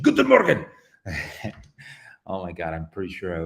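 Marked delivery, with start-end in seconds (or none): 0.0-0.7 high, loud shouted GUTEN MORGEN; mock harsh German. Streamer imitating happy Germans greeting, mock harsh shout. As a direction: fire off GUTEN MORGEN as if mad but affectionate. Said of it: "how the Germans show their affection".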